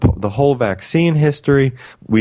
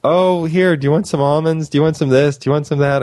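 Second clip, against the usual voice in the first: higher, fussing grandmotherly voice; doting grandmother imitation; 5 semitones higher than usual. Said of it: "like my wonderful mother or grandmother".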